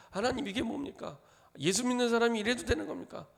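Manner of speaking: lightly voiced grumble at God; mock complaining believer. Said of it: "하나님 앞에 그렇게 얘기합니다". (하나님께 따지는 신자의 가벼운 항의)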